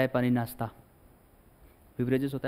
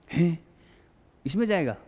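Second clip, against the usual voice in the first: higher-pitched startled question; mock disbelief; 4 semitones higher than usual. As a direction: play the let-down, astonished child. Acts child shocked by the train. (बनावटी हैरानी से पूछते हुए)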